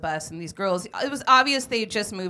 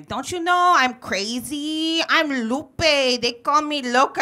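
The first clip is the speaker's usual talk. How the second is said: taunting bully; 7 semitones higher, 7 dB louder, slower than usual. Retold or imitated. Bully taunting the kid on the bus, loud and slow.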